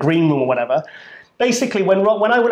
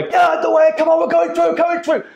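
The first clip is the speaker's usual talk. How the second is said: mock screaming; 13 semitones higher than usual. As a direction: shove through a crowd yelling. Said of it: "literally screaming their way".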